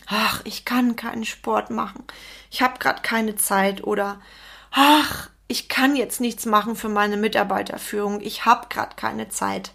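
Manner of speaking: mildly acted "ach, I can't" resignation; put-upon excuse with a sighing "ach"; fixed-mindset excuses. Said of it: "Menschen im fixen Mindset sagen immer".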